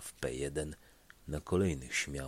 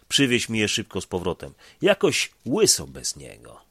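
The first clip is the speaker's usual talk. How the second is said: loud, cheerful call; hearty request; 3 semitones higher, 10 dB louder than usual. Acted. Stefan calling to the young driver, loud and cheerful. Stefan asks for sceptre back.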